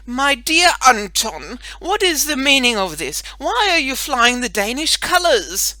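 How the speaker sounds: a grand lady demanding an answer across the yard; calling out. Acted. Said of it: "Missus Petrel called out to him".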